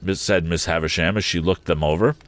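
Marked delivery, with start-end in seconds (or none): none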